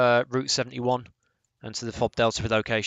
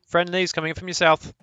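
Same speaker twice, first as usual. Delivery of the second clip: loud, raised call-out; 8 semitones higher, 7 dB louder than usual. Real, not acted. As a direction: warn the team across the field. Calling warning to team.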